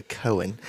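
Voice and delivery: "Cohen" said softly as KOH-in; "Cohen" instead of coin; the Iowa way to say coin. Shown as a demonstration. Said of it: "they don't say coins".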